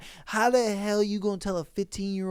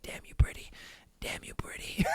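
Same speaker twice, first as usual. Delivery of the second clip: breathy mock whisper, much softer; creepy whisper; 12 dB quieter, faster than usual. Mocking the Walmart stalker's whispered compliments, creepy whisper. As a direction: whisper it like a creep in her ear. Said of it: "whisper a little bit like".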